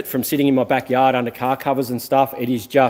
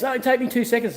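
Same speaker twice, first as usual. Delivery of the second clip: mocking the other driver; 10 semitones higher than usual. Quoting the driver's excuse to viewers, high mock voice. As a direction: mimic his lazy excuse up high. Retold car wash argument.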